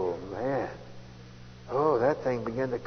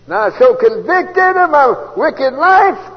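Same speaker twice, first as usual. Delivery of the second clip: loud slow preaching; 12 semitones higher, 13 dB louder, slower than usual. Real, not acted. Preacher's emotional climax.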